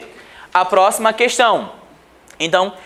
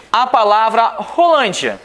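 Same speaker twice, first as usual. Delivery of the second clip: ordinary delivery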